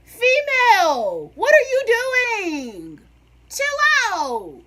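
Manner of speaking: high, loud sudden outburst; startled shout. Retold or imitated. Student yelling at the teacher in shock, loud and high. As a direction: yell it out in shock.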